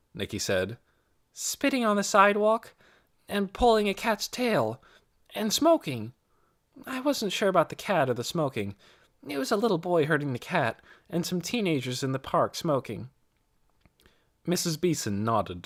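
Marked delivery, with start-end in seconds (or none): 1.3-13.1 a hint of a girl's higher voice; lightly voiced young girl. A narrator's reading. Nicky answers Mrs. Beeson, lightly voiced as a girl. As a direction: lightly voice Nicky, eager and polite.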